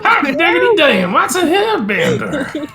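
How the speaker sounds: high, loud hammed-up hillbilly whoop; hillbilly accent. Hillbilly bit continued, laughing.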